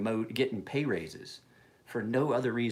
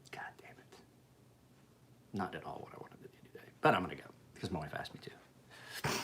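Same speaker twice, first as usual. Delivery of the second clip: much softer whispered grumble; real reluctant whisper; muttered whisper; 4 semitones lower, 8 dB quieter than usual. Real, not acted. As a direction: mutter it to yourself, annoyed. Grumbling about a chore.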